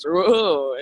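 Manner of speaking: loud drawn-out whoa of a sudden drop; thrilled whoa. Retold or imitated. To her listeners, acting out the coaster plunge, loud whoa. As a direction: let it burst out like the stomach dropping.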